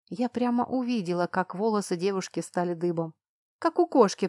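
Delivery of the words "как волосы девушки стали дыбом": ordinary delivery, nothing marked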